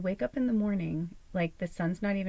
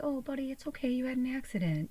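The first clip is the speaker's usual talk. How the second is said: gentle consoling; 7 semitones higher than usual. Pretend. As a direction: soothe an old pet kindly. Consoling an elderly pet.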